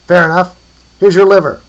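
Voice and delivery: loud, high shout; calling across distance. A narrator's reading. Handing over a liver from afar, calling out. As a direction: holler it over to the other trader.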